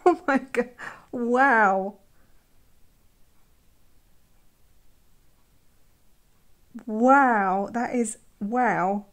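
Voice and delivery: loud, awed gasping wows; thrilled outburst. Real, not acted. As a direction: burst out over a gorgeous paint swatch. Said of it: "I'm becoming too excited here".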